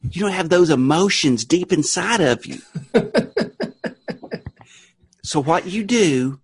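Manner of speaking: Southern revival-meeting witness; Southern testifying. Pretend. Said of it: "you're gonna start testifying to me".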